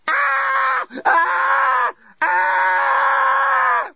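very high repeated shriek; sustained high screams in short bursts; primal scream. A narrator's reading. Lucy screaming aboard an alien spacecraft, primal scream. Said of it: "had reverted to primal scream mode".

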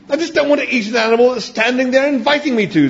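high, agitated, squeaky objection; indignant protest. A narrator's reading. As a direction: object in distress, voice pushed right up. Arthur's indignant objection in the story.

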